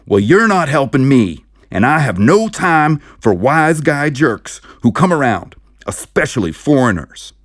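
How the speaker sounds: loud, high furious yelling; angry yell. Acted. Sheriff yelling at the saucer men to get out. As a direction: bellow them out of the office.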